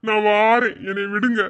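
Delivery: husband mimics his wife's plea, teasing; mocking her line. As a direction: parrot her line back to tease her. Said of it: "இந்த டைலாக்க விடவே மாட்டியா நீ?".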